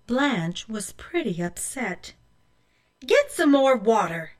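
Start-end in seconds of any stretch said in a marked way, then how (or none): 3.1-4.3 loud, commanding mother; ordering. A narrator's reading. Mother sends Blanche back, loud command. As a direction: bark it out as an order. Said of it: "Mother ordered".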